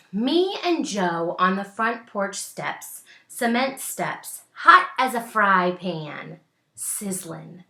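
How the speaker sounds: put-on slow, lively read-aloud to children; story reading. A narrator's reading. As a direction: savour each image for the kids. Reading picture book aloud.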